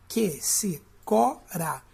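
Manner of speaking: "Que se cora" slow, every syllable detached. Shown as a demonstration. Teacher counting for students, "Que se cora" in separate syllables. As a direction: lean on "co" in a split-up "Que se cora". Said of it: "a sílaba tônica mais forte é o có".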